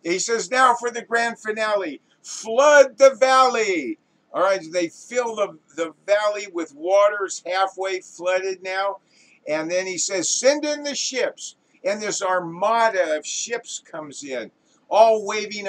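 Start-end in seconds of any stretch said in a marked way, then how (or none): 0.5-4.0 grand director's shout. Acted. Director ordering the grand finale, shouting. 10.3-11.6 very high calling-out voice; director's call. Acted. Director cueing the armada of ships, calling out.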